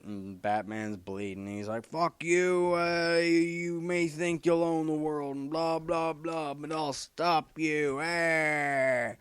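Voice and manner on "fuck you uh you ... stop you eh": much higher, slow Batman defiance; Batman, first try. Retold or imitated. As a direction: growl defiance, pitched high and slowly. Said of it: "as Batman might say".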